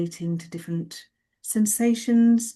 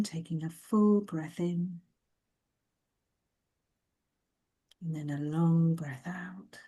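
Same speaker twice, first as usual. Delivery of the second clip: guided meditation. Acted. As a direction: gently lead listeners into the body, leaving space to breathe. Begins leading the body scan meditation.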